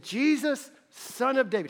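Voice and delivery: a touch of the high cry; mild calling out. Retold or imitated. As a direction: lightly echo his call. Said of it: "When he said".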